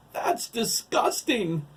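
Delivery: high, loud, drawn-out revulsion; disgusted cry. A narrator's reading. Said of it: "J T cried".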